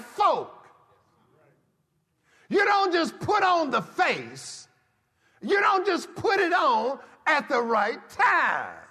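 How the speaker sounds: loud, high, rising sermon cadence; fiery pulpit exhortation; preaching at full force. Acted. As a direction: drive it home to the congregation.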